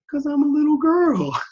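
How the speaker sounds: put-on high, loud childlike whine of an answer; imitated little girl. Dad imitating his seven-year-old's answer, little-girl voice.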